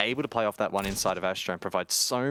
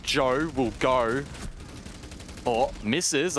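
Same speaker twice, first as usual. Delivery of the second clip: excited call; 5 semitones higher, much slower than usual. Real, not acted. Caster calling Joe's push live, drawn out and excited. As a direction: stretch the call as the play unfolds.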